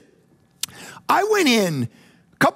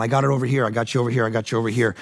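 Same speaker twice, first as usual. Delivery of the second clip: gentle God; 8 semitones lower, much faster than usual. Retold or imitated. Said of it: "And God's going".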